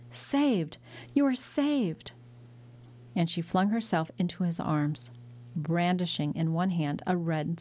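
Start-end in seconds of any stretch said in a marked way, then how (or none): 0.1-2.1 higher, overjoyed announcement; breathless joy. Acted. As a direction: tell father the good news in rush. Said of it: "breathless and almost fainting with joy".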